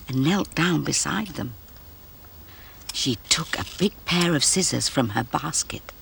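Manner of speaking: usual speaking voice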